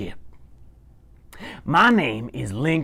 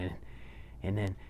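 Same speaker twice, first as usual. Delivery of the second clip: usual speaking voice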